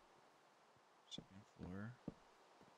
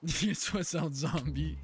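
laughing panic; 4 semitones higher, 9 dB louder than usual. Real, not acted. Laughing amid zombie horde.

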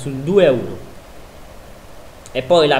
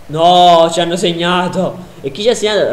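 dismayed shout; 5 semitones higher, 9 dB louder than usual. Real, not acted. Conceding a goal.

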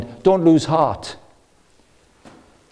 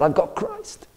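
lifted much higher, sparkling delight; much higher and softer, quietly thrilled; eyes sparkling; 6 dB quieter, slower than usual. Retold or imitated. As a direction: say it with shining eyes and a rising lilt. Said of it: "couldn't stop his eyes sparkling when he said".

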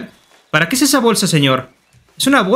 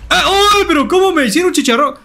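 pained cry; 8 semitones higher than usual. Real, not acted. Shot down by police.

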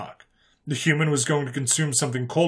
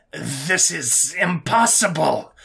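slow, drawn-out, higher-pitched hiss; hissed disbelief; 4 semitones higher, 3 dB louder, much slower than usual. Acted. Alien prisoner watching the human eat wings, hissed. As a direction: stretch it out in appalled disbelief. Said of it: "Gregor hissed".

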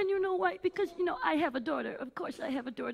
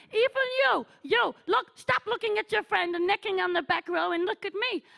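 diva scolding; 4 semitones higher, 6 dB louder than usual. Acted.

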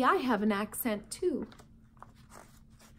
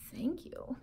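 whispered thanks; 11 dB quieter than usual. Real, not acted. Whispered aside.

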